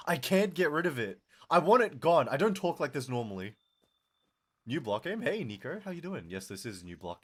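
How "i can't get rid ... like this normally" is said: loud, raised, fed-up outburst; exasperated. Real, not acted. Streamer ranting about their own streamer voice, exasperated. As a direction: complain loudly, wanting rid of it.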